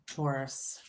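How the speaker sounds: normal talking voice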